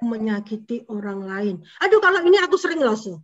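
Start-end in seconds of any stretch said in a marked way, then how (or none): 1.8-3.1 high, loud acted admission; child confessing. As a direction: blurt it out like a kid owning up.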